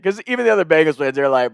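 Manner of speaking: ordinary talk, nothing marked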